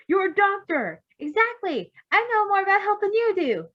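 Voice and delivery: high, loud indignant outburst; irate sputtering. Acted. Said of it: "Cue irate sputtering".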